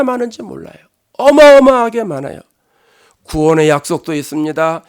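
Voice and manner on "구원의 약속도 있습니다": usual speaking voice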